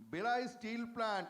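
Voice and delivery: ordinary talk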